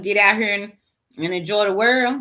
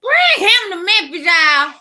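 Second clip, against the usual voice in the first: much higher, louder furious call; angry shout; 10 semitones higher, 5 dB louder than usual. Real, not acted. Ranting to viewers about the protests, angry shout. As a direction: shout the demand at the crowd.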